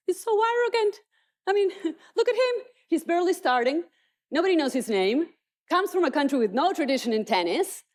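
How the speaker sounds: high, sneering put-down of a newcomer; mocking critics. Critics mocking the unknown young player, high and sneering. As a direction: scoff at the upstart from above.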